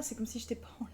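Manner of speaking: soft intimate whisper; right in your ear. Real, not acted. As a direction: whisper it close to the mic. Cosy chat on stream.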